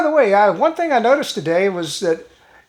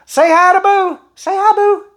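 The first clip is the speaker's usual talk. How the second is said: higher, louder pet-talk voice; 10 semitones higher, 4 dB louder than usual. Real, not acted. Owner asks Boo to greet viewers, sweet high pet-talk.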